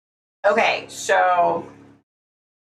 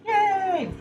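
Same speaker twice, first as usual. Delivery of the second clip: squealed high sarcastic yay; a tiny ironic cheer; mock cheer; 12 semitones higher than usual. Real, not acted. Vlogger cheering sarcastically at a packed work week, squeaky mock cheer. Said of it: "mock cheer at workload".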